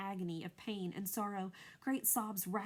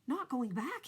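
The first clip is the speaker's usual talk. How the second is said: cried out; 5 semitones higher, 4 dB louder than usual. A narrator's reading. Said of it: "he cried".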